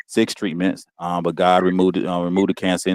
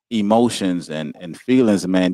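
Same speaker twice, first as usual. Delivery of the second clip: ordinary talk, nothing marked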